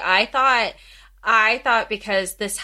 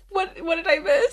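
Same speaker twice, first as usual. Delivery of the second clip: baffled; 8 semitones higher than usual. Retold or imitated. Baffled reaction retold.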